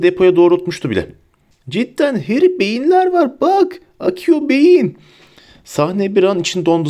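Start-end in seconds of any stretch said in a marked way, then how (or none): 1.7-5.0 very high, stretched-out tipsy squeal; silly high wailing wonder; giddy, drunken Ron. A narrator's reading. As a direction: marvel like a giggly drunk. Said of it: "kıkırdayarak, sarhoş gibi yalpalayarak".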